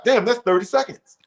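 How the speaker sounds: high, sly glee; gleeful inner thought. Retold or imitated. Newcomer checking his watch mid-answer, high gleeful aside. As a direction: savor the time ticking. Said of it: "I'm looking at my watch".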